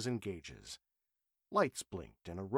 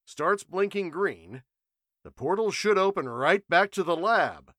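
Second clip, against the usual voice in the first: louder, earnest warning; cautious scientist; 5 semitones higher, 8 dB louder than usual. A narrator's reading. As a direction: warn them without looking up. Matthews voiced, explaining.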